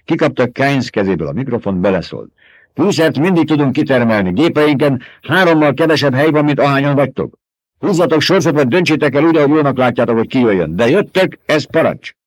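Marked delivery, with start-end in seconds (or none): 2.7-12.1 higher, louder voice of command; commanding order. A narrator's reading. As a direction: give an order that allows no argument. Duke orders crew over radio.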